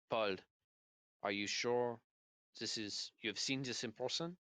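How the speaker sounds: put-on halting questions in a French accent; French-accented English, careful and broken up; slight French accent. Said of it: "a slight French accent".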